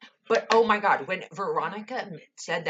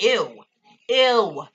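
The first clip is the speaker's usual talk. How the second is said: disgusted ew; 3 semitones higher, 8 dB louder than usual. Real, not acted. Disgusted at Hal Cooper.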